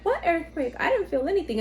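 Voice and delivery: high-pitched puzzled denial; mock innocent bafflement; imitated oblivious husband. Husband's oblivious reply acted out.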